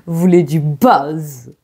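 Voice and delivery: low, slow knowing tease; sly low mock accusation; teasing. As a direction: drop low and drawl it slowly. Teasing viewers.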